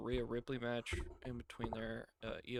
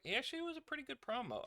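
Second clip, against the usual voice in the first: mock quote; 12 semitones higher, 3 dB quieter, faster than usual. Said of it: "He's like".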